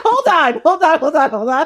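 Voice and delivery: loud, high, repeated protest; mock panic, pushed up in pitch and volume; alarmed. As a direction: act out a startled man calling a halt. Prince recoils on seeing her face.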